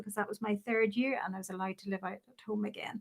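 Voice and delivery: ordinary talk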